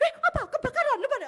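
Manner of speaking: high, loud acted alarm; startled husband. Husband hears his wife yelling, startled. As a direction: blurt it in a jolt of panic.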